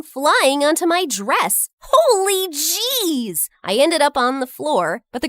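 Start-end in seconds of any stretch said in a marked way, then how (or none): 1.8-3.5 drawn-out shocked gasp of words; startled exclamation. Acted. Narrator cries out as paint hits her dress, startled. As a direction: jolt at being splattered with paint.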